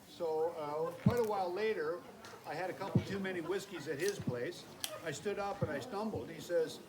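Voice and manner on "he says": ordinary talk, nothing marked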